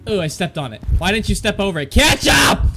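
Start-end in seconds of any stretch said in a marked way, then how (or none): 1.9-2.7 loud, high yell; sudden shout. Real, not acted. Player snapping at a lagging game, sudden loud shout. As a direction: yell it out, impatient.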